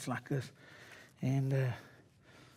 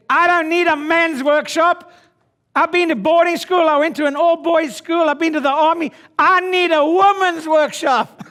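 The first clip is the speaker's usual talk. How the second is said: high and loud, hammed-up bragging; loud mock boast; 11 semitones higher, 11 dB louder than usual. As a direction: belt it out like a cocky joke. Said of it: "I said".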